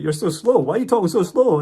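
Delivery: high, loud mock complaint; exasperated buddy. Buddy to friend in the bank, exasperated.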